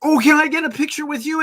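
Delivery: high, eager fan voice; gushing fan. Retold or imitated. Woman asking the quilters for a picture, gushing high. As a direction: gush like a starstruck admirer. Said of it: "thought she was gonna have a heart attack".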